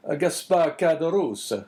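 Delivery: put-on slow priest in a light Italian accent. A narrator's reading. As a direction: weigh the name, Italian accent. Priest voice in story.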